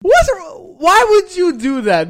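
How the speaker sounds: high, loud, shocked why; high incredulous. Retold or imitated. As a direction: squeak out shocked disbelief. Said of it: "I look at him like".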